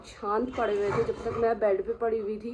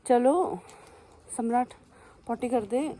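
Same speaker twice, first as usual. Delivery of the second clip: coaxing the dog; 4 semitones higher, 4 dB louder, slower than usual. Real, not acted. Urging dog outside.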